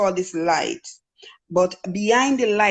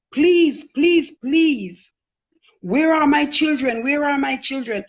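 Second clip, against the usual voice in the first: high, louder desperate pleading; retold frantic scream; 6 semitones higher, 3 dB louder, slower than usual. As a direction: cry out begging for your lost children. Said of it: "I was screaming".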